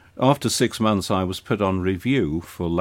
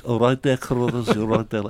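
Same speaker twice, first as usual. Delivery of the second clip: playful put-on Scouse accent, pitched up; Liverpool accent; 4 semitones higher than usual. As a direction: lay on the Liverpool accent to tease an old superior. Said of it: "that awful Liverpool accent".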